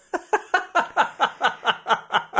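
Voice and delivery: laughing. Real, not acted. Laughs at own joke.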